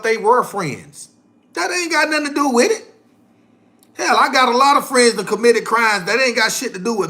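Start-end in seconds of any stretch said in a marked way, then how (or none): none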